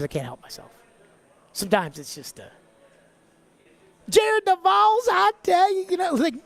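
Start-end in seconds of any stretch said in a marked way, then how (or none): 4.1-6.3 put-on Southern accent. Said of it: "use your Southern accent".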